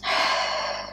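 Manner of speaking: a long soft sigh out; demo exhale. Shown as a demonstration. Teacher showing how to breathe with a sigh. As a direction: breathe out slowly and audibly. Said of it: "Breathe, Halima".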